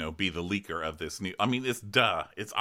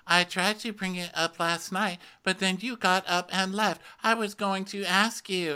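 imitating Whitney; 9 semitones higher than usual. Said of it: "Whitney's like".

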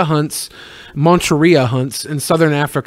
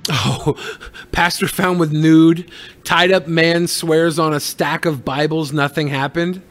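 ordinary talk

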